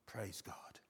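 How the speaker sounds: soft whispered praise. Real, not acted. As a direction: breathe it out as a private thanks. Quiet personal praise.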